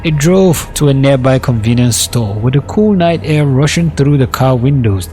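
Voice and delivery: ordinary delivery, nothing marked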